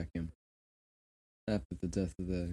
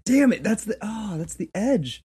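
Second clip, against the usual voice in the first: much higher, louder frustrated yelp; exasperated outburst; 13 semitones higher, 11 dB louder, slower than usual. Real, not acted. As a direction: blurt it out in exasperation. Mock outrage at orphan twist.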